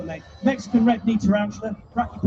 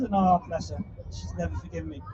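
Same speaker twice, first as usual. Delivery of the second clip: usual speaking voice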